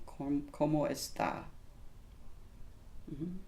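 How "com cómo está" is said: ¿Cómo está? said softer, more formal and careful; quieter, polite formal version; softer formal take. Shown as a demonstration. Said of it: "a little more on the formal".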